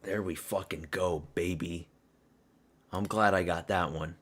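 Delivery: low, soft, contented murmur; quietly pleased, voice dropped; satisfied afterglow. Real, not acted. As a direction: say it low, savoring the win. Winding down after the win.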